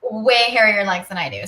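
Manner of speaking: normal talking voice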